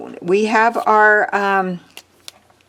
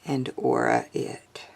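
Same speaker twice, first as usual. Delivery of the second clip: hushed unvoiced whisper; 6 semitones lower, 10 dB quieter than usual. Shown as a demonstration. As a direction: whisper the words under your breath. Repeat drops to a whisper.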